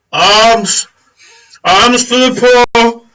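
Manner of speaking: high, loud, drawn-out street cry; calling for alms. Acted. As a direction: bellow it out to the whole crowd. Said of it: "And he goes".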